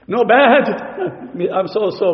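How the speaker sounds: usual speaking voice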